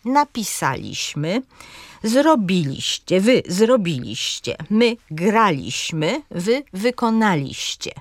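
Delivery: akcent na SA, BI, GRA, NA w „napisaliśmy, zrobiliście, graliśmy, wykonaliście”; wzorcowo, z trzecią sylabą od końca mocną: „napisaliśmy, zrobiliście, graliśmy, wykonaliście”; akcent proparoksytoniczny. Shown as a demonstration. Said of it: "Na trzecią sylabę od końca akcent pada". (akcentuj starannie „napiSAliśmy, zroBIliście, GRAliśmy, wykoNAliście”)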